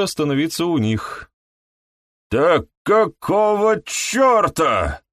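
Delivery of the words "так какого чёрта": high, slow, strained outcry; anguished exclamation. Acted. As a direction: cry it out in shock. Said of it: "воскликнул Гай с таким надрывом".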